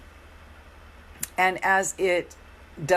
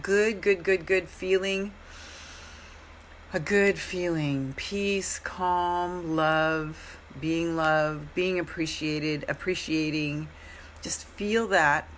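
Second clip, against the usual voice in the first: guided meditation; slower than usual. Acted. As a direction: guide them gently into the feeling. Leads a feeling meditation.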